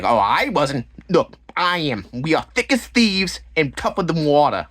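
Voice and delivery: mock reality-show housewife; housewife impression. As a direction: declare it grandly, imitating her. Imitates housewife's repeated clip.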